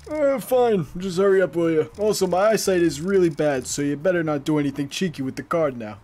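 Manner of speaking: put-on a shaky old shop clerk grumbling at the customer; Biden impression. Streamer voices the clerk as a Biden impression. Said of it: "Was that a good Biden impression?".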